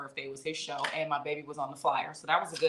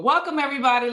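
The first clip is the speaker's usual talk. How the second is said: higher, louder, bright welcome; excited host welcome; 7 semitones higher, 7 dB louder, faster than usual. Acted. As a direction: welcome everyone with a lift. Host re-opens the show.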